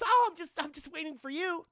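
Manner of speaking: high-pitched, over-casual mom voice; put-on motherly excuse; mock innocent mom. Mom covers for waiting outside.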